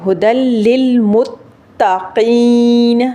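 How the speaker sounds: slow "ہدل للمتقین" with a doubled, firm ت; drawn-out tajweed reading of "ہدل للمتقین"; firm taa. Shown as a demonstration. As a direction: recite with pressure on ت and a long final ee in "للمتقین". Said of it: "تا کے اوپر تھوڑی سی سختی کریں گے".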